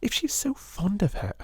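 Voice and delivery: high spiteful whisper. Acted. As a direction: keep it hushed and catty. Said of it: "exclaimed Lavinia in a whisper".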